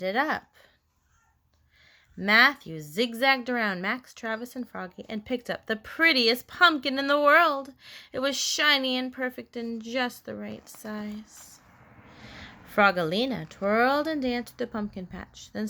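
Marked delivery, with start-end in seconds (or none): none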